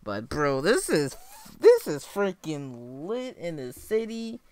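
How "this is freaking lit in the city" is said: high, slow, hyped; hyped-up bro. Real, not acted. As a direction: savour the hype. Hyped about the track.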